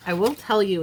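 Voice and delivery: ordinary talk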